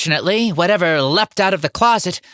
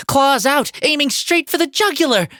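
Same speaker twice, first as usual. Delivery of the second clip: alarmed narration; 9 semitones higher than usual. A narrator's reading. Narrator as the hamster leaps at him, alarmed. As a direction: pitch jumps high in mild panic.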